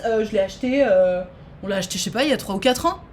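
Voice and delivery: normal talking voice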